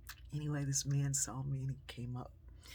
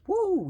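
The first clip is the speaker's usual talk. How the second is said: high, loud wolf-whistle woo; husband whooping at his wife; 13 dB louder than usual. Retold or imitated. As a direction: whoop like before. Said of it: "And he says".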